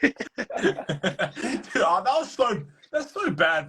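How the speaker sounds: high-pitched, laughing through the words. Real, not acted. Reacting to a friend dropped off screen, laughing. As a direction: let the laugh break into it.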